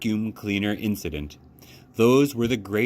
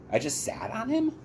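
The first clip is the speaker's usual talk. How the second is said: surprised; 4 dB quieter than usual. Real, not acted. Surprised by child's toy.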